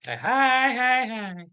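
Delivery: high, put-on womanly voice for a playful hey; woman's voice. Streamer greets his chat in a high womanly voice. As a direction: squeak the heys up high like a woman. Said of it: "Makes me sound like a woman".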